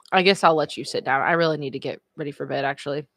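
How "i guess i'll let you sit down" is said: normal talking voice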